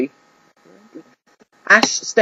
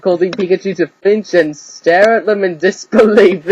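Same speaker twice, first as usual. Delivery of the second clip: plain reading voice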